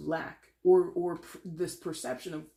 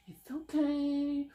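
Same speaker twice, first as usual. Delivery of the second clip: high, drawn-out fake soothing; mock coddling; 9 semitones higher, much slower than usual. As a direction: coo slowly as if babying someone. Mimics hand-holding comfort she refuses.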